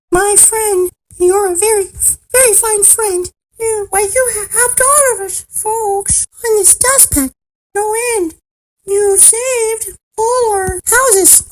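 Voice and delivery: very high, squeaky little mayor; tiny speck voice. A narrator's reading. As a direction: thank them in a tiny, high-pitched voice. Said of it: "The voice was so faint".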